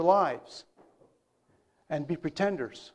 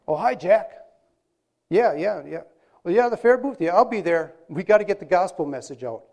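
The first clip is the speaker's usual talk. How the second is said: louder, eager churchgoer on the phone; put-on hearty phone manner; 6 dB louder than usual. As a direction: act suddenly upright and keen for a caller. Acted husband on phone, pious front.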